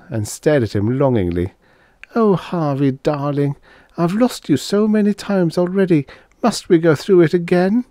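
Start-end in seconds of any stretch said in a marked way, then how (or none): 2.1-7.8 high, pleading, sorrowful voice; sadly. Acted. Dana pleading sadly with Harvey not to repeat it. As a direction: plead wearily with him. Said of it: "she said sadly".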